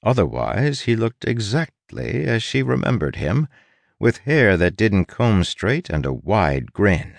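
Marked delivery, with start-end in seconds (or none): none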